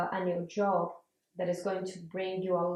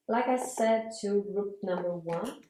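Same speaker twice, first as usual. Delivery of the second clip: normal talking voice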